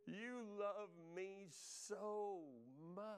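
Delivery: long, sustained heartfelt declaration; drawn-out awe. Retold or imitated. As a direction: linger on every word in awe. Repeats it to God, drawn out.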